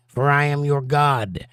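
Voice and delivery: ordinary talk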